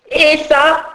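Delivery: high, loud scolding teacher voice; teacher scolding the late fairy, high and loud; stern schoolmistress. Acted. Teacher inspecting.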